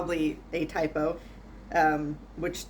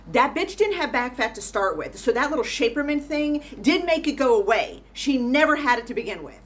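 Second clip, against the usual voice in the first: mock outrage; 6 semitones higher, 5 dB louder than usual. Real, not acted. Ranting about a pet peeve.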